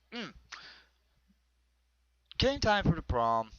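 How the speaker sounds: normal talking voice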